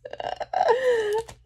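a burst of high giggling; real high-pitched laugh; high delighted laugh. Real, not acted. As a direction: squeal of laughter at the gift. Laughing at a funny card.

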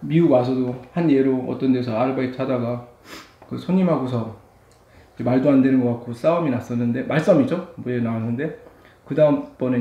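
chewing between words; eating while talking; mouth full of noodles. Real, not acted. Seen 3: Took noodle bite at -1.0; jaw and cheeks chewing while speaking.

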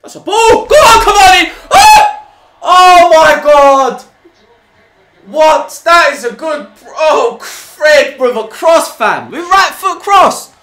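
high, loud yells, stretched out in disbelief; real fan screaming through a counter-attack; excited shouting. Real, not acted. Fan screaming at a Cavani chance, excited shouting.

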